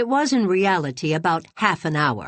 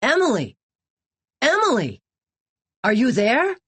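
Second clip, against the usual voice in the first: high, raised call across the distance; worried young man calling for Emily; 6 semitones higher, 3 dB louder than usual. A narrator's reading. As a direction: call her name from outside. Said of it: "Teddy's voice calling to her".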